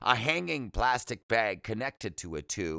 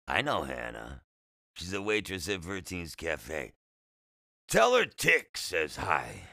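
low, slurred patient character; raspy, slurred voice; 4 semitones lower than usual. A narrator's reading. Patient talking to the boy about his mother, raspy and slurred. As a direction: slur it low with a rasp. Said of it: "He spoke with a raspy, slurred voice".

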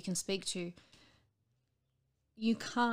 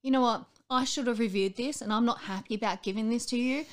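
louder, higher mock grumbling doctor; unhappy doctor; 4 semitones higher, 6 dB louder than usual. Doctor complains to patient about transfusion, louder mock grumble. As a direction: imitate the doctor fussing. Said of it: "the doctor's up there going".

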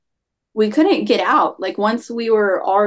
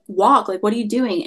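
ordinary talk

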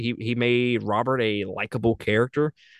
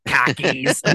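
mock Boston accent, R dropped; bad Boston accent. Shown as a demonstration. Mocking an actor to his co-host, fake Boston accent. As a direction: put on a clumsy Boston "car keys". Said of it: "Boston accent. That's how it sounded".

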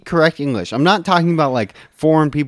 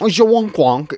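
mock cockney gibberish; 5 semitones higher, 5 dB louder than usual. Imitating blue collar accent gibberish.

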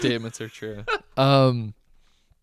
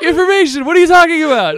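much higher, louder disbelief; incredulous; 14 semitones higher, 8 dB louder than usual. Real, not acted. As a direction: protest in amused disbelief. Exasperated laughing protest.

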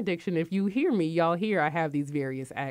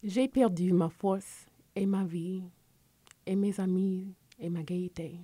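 French poem recital. Shown as a demonstration. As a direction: recite it like a school poetry contest piece. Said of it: "I had to recite a poem".

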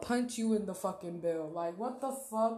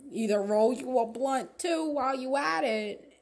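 louder, driving hype; loud push; 3 semitones higher, 7 dB louder than usual. Real, not acted. Telling viewer to hustle, loud push. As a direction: push them hard and loud.

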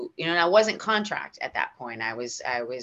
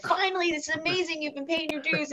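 excited congratulations; 13 semitones higher than usual. Retold or imitated. Co-star congratulates her.

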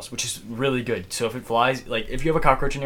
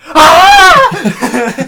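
very high, loud shriek; piercing yell reenacting a friend's fright; imitated scream; 17 dB louder than usual. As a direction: scream out in mock terror.

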